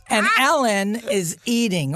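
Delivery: normal talking voice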